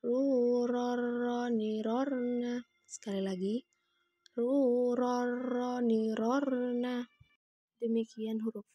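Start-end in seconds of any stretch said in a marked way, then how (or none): 0.0-2.6 "Ru, ro, ro" mengalun dengan r bergetar; suara diayun. Shown as a demonstration. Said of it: "ayunkan lagi suaranya". 4.4-7.0 "Ru, ro, ro" mengalun dengan r bergetar; suara diayun. Shown as a demonstration. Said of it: "ayunkan lagi suaranya".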